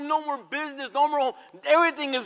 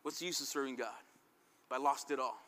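defeated man; 9 semitones lower, 9 dB quieter than usual. Retold or imitated. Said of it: "most men would say".

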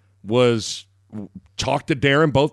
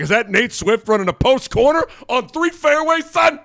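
mock incredulous; 11 semitones higher than usual. Host reliving spotting a player on the fairway, mock incredulous. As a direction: holler it in exaggerated amazement. Acting out his astonished reaction.

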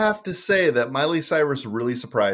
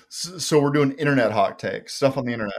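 usual speaking voice

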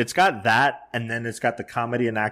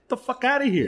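disbelief; 12 semitones higher than usual. Retold or imitated. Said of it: "I'm like".

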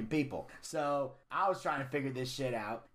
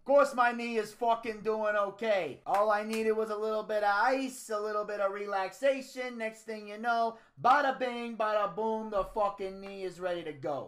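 higher-pitched mobster Don; Tony D; 8 semitones higher than usual. Acted. The Don assuring everyone his knee is fine, cocky. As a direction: play a cocky Italian-American wrestling Don.

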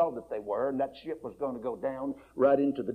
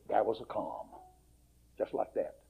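hushed calm; 5 semitones lower, 8 dB quieter than usual. Acted. Describing the sudden calm.

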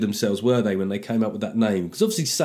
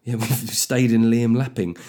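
ordinary talk, nothing marked

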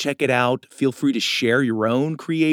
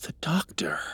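whispering; 7 semitones lower, 7 dB quieter than usual. Acted. Quiet dungeon reveal.

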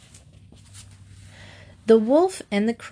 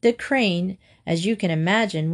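ordinary delivery

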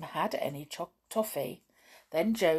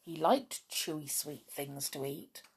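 usual speaking voice

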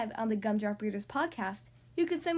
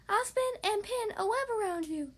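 higher, perky spider; spider lady voice; 8 semitones higher than usual. Acted. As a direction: chirp the idea brightly. Spider offers help.

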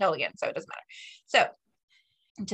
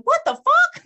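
high, loud shocked shout; startled yell; 9 dB louder than usual. Retold or imitated. Wife jolted awake yelling at husband in the night. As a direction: yelp it like you just jumped up. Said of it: "Like, really loud".

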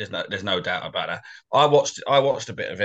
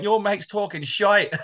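a mock Mancunian accent, pitched higher and slow; bad Mancunian accent; 9 semitones higher, slower than usual. Builder mocking fans' retort in bad Mancunian accent. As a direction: try a Manc accent and miss. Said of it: "the worst Mancunian accent ever".

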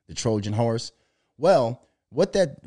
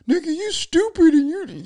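much higher, louder squeaky mock voice; mock angry commenter; 5 dB louder than usual. Mocking a ranting commenter in a high, loud squeal.

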